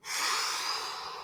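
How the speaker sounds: soft, airy exhale; long breath out. Shown as a demonstration. Instructor breathing along with the count, long soft exhale. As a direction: let out a long, soft rush of air.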